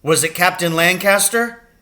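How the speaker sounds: loud, high demand; angry father. A narrator's reading. Furious father demands whether Captain Lancaster did it, loud. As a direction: press him hard, angry.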